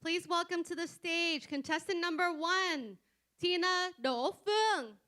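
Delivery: loud, high stage introduction; announcer voice. Real, not acted. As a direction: call her name out across the hall. Host calling contestant to stage.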